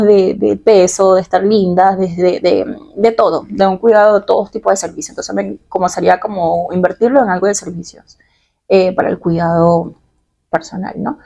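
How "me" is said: normal talking voice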